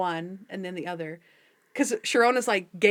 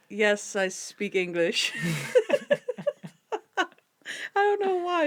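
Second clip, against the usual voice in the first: slow quote broken by laughter; laughing; slower than usual. Real, not acted. Quoting the reply while still laughing. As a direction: giggle through the quote.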